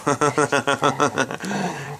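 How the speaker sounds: long rolling chuckle. Real, not acted. Storyteller amused by his own uh-oh, rolling chuckle. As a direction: laugh at his own joke.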